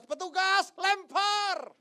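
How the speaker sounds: high, loud barking order; mock shout. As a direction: yell at the workers to throw it in. Ordering the grave workers. (teriakan perintah pura-pura)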